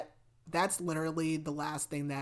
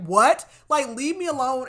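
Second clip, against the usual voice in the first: indignant; 8 semitones higher, 10 dB louder than usual. Real, not acted. Indignant reaction.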